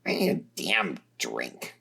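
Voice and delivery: weary and done; fed-up Tarzi. A narrator's reading. Tarzi, after the meeting, low and weary.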